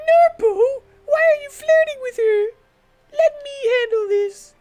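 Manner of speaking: put-on high pouty jealous girl. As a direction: whine it like a jealous teen companion. Jealous companion's voice.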